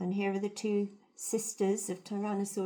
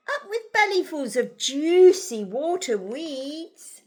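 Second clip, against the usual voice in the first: loud, slow, rolling cheer; hooting; 6 semitones higher, 10 dB louder, slower than usual. A narrator's reading. Narrator voices duckbills delighted by their food, hooting. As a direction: relish it as a hooted chant. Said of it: "And they hooted".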